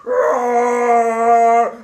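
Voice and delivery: loud playful tiger roar. Pretend. Tiger roaring at Pharaoh, loud and playful. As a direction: roar like a big cat.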